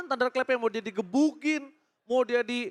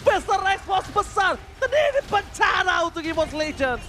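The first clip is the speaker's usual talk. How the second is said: rising hype play-by-play, higher, louder; esports caster; 6 semitones higher, 3 dB louder than usual. Acted. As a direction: call the teamfight with building excitement. Caster calls a teamfight live.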